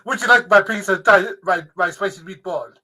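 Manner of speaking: hammed-up Italian pizza-man voice; mock Italian accent. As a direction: ham up an Italian waiter offering meatballs. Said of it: "I was gonna do Russell Crowe like you do".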